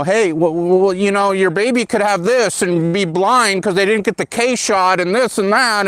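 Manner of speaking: mocking a pushy hospital doctor; mock doctor sales pitch. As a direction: parody a pushy doctor selling a shot. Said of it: "brought in multiple doctors to sell us".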